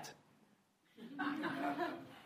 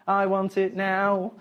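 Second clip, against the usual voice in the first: drawn out; 4 semitones higher, much slower than usual. Shown as a demonstration. Childish demand said twice.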